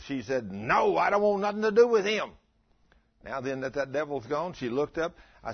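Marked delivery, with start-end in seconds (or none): 0.5-2.3 high, loud voice of a defiant woman. Retold or imitated. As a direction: snap back at the preacher with a high, loud refusal. Possessed woman refuses Jesus.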